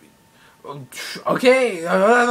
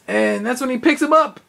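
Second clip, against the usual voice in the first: usual speaking voice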